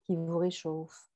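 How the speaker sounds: subdued, unhurried verse reading; poetry reading. Acted. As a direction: read each short line apart, letting it settle. Reads a quoted poem aloud.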